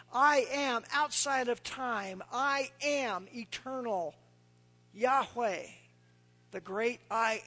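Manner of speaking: slow, ringing, each I am hammered; preaching at full force. Acted. Preacher booms God's own words to the congregation, slow and grand. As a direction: build the list like a proclamation from heaven.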